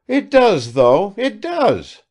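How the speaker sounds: high, loud, insistent exclaim; exclamation. A narrator's reading. Evdoksya contradicts Sitnikov with a loud exclaim. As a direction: throw it back at him, slowing at the end. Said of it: "exclaimed Evdoksya".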